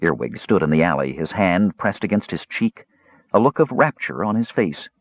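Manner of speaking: ordinary delivery, nothing marked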